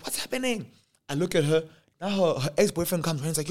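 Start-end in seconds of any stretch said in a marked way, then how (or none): 0.0-0.7 put-on high confused girls.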